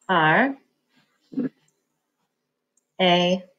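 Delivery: letters named with long pauses; teacher spelling aloud slowly. Shown as a demonstration. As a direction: spell it out one letter at a time. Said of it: "let's spell it away".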